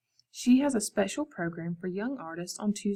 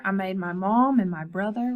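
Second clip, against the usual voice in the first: usual speaking voice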